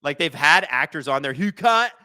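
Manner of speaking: usual speaking voice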